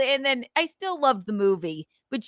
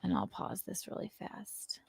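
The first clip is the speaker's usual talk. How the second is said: whispered aside; 16 dB quieter than usual. Real, not acted. Aside while pausing the video.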